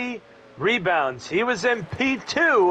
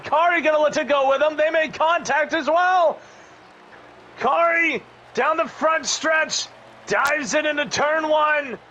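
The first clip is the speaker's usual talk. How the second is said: rising excitement, higher and faster; excited; 7 semitones higher than usual. Real, not acted. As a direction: call the pass as it unfolds. Battle for position on track.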